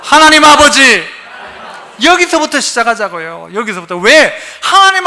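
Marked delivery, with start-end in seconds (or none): 0.0-1.2 하나님 아버지 shouted, slow and loud; the whole congregation crying to God; shouted prayer. Shown as a demonstration. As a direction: cry out 하나님 아버지 with full force. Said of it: "한번 해볼까요?".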